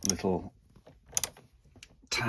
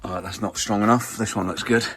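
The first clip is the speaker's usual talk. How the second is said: ordinary talk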